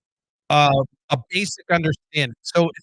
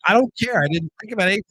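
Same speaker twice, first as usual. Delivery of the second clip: ordinary talk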